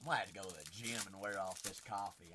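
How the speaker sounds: caffeine-wired fast talk; too much caffeine. Real, not acted. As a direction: rattle it off, jittery on coffee. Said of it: "I am wired right now".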